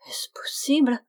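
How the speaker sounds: whispered disbelief, voice rising on the word; stunned whisper. A narrator's reading. Stunned friar repeating himself in disbelief, whispered. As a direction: whisper it in amazement. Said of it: "répétait le moine convers".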